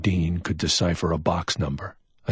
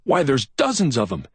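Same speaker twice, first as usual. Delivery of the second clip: higher, amazed exclamation; excited cry; 9 semitones higher, 4 dB louder than usual. A narrator's reading. McMain finds dozens of gems, excited cry. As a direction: cry out at the find. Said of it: "McMain cried".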